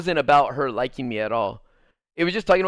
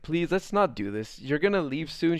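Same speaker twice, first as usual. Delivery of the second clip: softer, gentle plea to stop fighting; soft pleading; 6 dB quieter than usual. Retold or imitated. Begging his girlfriend not to fight before her flight, softly.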